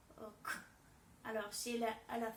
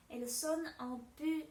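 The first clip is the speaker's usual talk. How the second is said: ordinary talk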